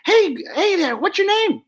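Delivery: high, brash dummy voice; Joey the dummy. Acted. Dummy flirting with the lady down front, high and brash.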